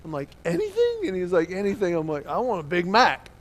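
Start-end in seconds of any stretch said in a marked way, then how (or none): 0.4-1.1 high-pitched incredulous squeak; acted kid who cannot believe the luck; amazed disbelief. Kid amazed at dad's offer. 2.2-3.3 loud, eager announcement; acted kid grabbing the chance; excited kid ordering.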